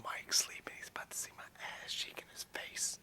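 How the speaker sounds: hushed whisper so the sleeper won't wake. Real, not acted. Filming near sleeping Mike, whispered to the camera. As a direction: whisper right beside a sleeping friend.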